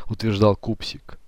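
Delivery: ordinary delivery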